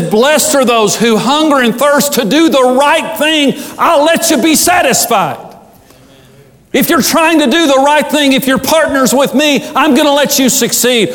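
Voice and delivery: high, shouted sermon peak; full-voiced revival preaching; preaching at full force. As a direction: preach it out with fire. Preacher builds to a shouting climax.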